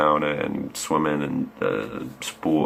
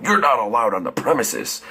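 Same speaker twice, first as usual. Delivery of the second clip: raised put-on voice of officious staff; mock stern staff; 6 semitones higher than usual. Gym staff turning away a banned member, high mock-stern voice. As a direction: imitate a strict refusal at higher pitch.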